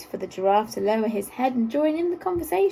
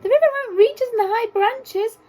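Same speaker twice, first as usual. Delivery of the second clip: calling monkeys; 12 semitones higher, 6 dB louder than usual. A narrator's reading. Monkeys in treetops calling the animals up, high and loud. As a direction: call down from the treetops. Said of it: "called the monkeys".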